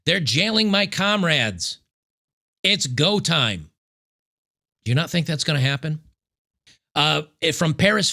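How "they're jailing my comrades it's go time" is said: starts much higher, then slows into a flat mutter; mock crazy rambler. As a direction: play a paranoid zealot working himself up. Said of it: "this crazy person rambling is gonna say".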